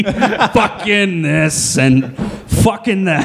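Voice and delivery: very slow, drawn-out mocking impression; Lee. Co-host mocking a friend's impression, slowly drawn out. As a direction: stretch every word, laughing at the imitation. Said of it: "That's your impression of Lee".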